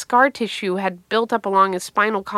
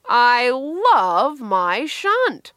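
very slow, swooping declaration with higher pitch; mock adoration; 4 semitones higher, much slower than usual. Brother's sarcastic refrain about his shunt, hammed-up adoration. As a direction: draw out every word in exaggerated devotion.

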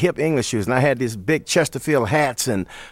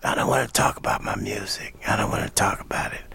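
soft whispered rasp; imitated gruff, voiceless refusal; 3 semitones lower, 6 dB quieter than usual. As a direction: brush it off in a hoarse whisper. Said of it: "I remember him saying something like".

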